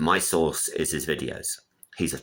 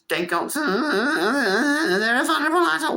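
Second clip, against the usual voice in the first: mock bureaucrat; 3 dB louder than usual. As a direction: parody the rule-makers pitched way up. Mocking official regulation talk.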